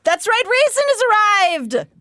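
high, loud fangirl cheer; excited. Real, not acted. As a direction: announce him with giddy glee. Excited fan announcing.

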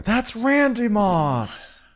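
high slow punchline. Acted. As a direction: drag the punchline out. Joke punchline.

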